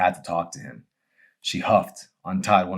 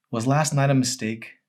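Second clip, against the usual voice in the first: higher, small hurt question; lightly voiced Emery, hurt; hesitant question; 8 semitones higher, 5 dB louder than usual. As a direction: ask it after a pause, afraid of the answer. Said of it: "she asked, after a short pause".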